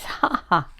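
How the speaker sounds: loud, triumphant crowing; gloating shout. Acted. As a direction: crow it like a childish winner. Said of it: "shouting at the top of his voice".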